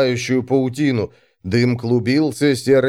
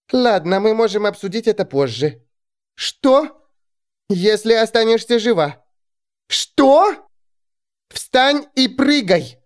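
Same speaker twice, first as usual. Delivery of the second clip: high raised voices over wind; shouting over wind; 9 semitones higher than usual. Acted. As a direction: yell back and forth. Shouted exchange on the mast.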